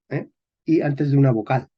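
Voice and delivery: normal talking voice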